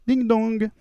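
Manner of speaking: sung doorbell chime; doorbell ding dong. Acted. Doorbell imitated in sketch.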